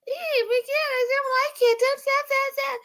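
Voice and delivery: very high giggly squeal, being tickled. Retold or imitated. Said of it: "that whole, like".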